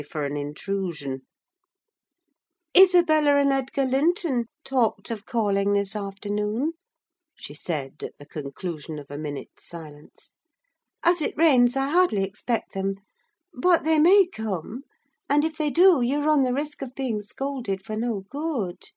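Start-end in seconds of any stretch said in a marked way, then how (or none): 2.8-6.7 put-on high-pitched girl's character voice; young Catherine. A narrator's reading. Catherine in dialogue. 11.0-18.9 put-on high-pitched girl's character voice; young Catherine. A narrator's reading. Catherine warns Heathcliff.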